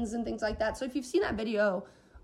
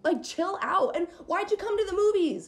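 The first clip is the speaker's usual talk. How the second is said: higher-pitched, exasperated scolding; pitched-up mock rant; retold indignant telling-off; 8 semitones higher than usual. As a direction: send them packing, without shouting.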